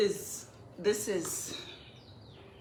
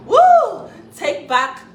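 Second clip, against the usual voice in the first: loud, high triumphant cheer; excited whoop; 14 dB louder, much slower than usual. Real, not acted. Sister cheering to take back her narrative, loud whoop. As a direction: let out a victory whoop.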